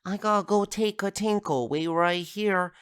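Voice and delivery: put-on high kid voice, slowing at the end; imitated little boy. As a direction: put on a small child announcing a potty break. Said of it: "And then Andy's like".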